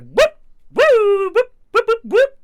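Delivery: high, loud whooping siren noises; imitated police siren. Driver mimicking the car that pulled him over, loud siren whoops.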